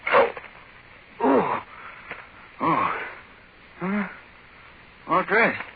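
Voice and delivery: hungover groaning. Acted. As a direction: wake up aching and dazed.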